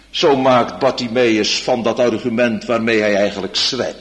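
usual speaking voice

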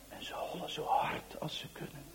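hushed whispered narration; whispered suspense. A narrator's reading. Narrator hushing the children's flight across the field, whispered. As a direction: whisper it, breathless chase.

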